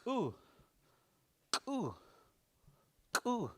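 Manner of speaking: harsh K burst into high, falling "Ooh"; exploding K. Shown as a demonstration. Teacher repeating the dramatic sound, hard K on "Ooh". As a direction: pop the K hard before "Ooh". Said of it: "sounds like that".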